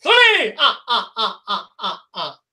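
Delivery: rising counting with vampire laughs; Count von Count laugh. Retold or imitated. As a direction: count each number, then cackle. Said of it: "Joseph says".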